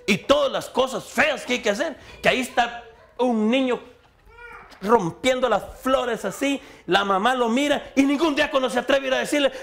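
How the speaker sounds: high-pitched, emphatic sermon delivery; fervent preaching. Real, not acted. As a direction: preach it out, stretching the key words. Preaches at full force. (prédica enérgica y aguda)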